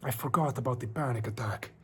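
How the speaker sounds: put-on measured storytelling in a Spanish accent; Spanish vampire character. Streamer reading creepypasta to chat as a Spanish vampire. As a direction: narrate as a brooding Spanish vampire. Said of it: "A Spanish vampire character".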